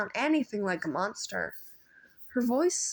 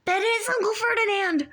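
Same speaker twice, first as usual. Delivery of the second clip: higher, louder shocked gasp; gasped alarm; 13 semitones higher, 5 dB louder than usual. A narrator's reading. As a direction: realize in horror. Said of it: "Grover gasped".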